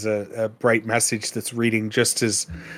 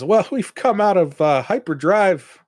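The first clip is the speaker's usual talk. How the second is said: higher-pitched announcing captain voice; Burt Reynolds; 7 semitones higher, 4 dB louder than usual. Acted. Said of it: "Burt Reynolds says".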